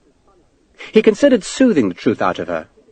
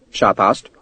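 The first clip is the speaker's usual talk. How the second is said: plain reading voice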